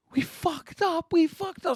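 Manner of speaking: high-pitched, drawn-out mock panic; panicking. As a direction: squeal it as anxiety takes over. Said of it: "an anxiety attack".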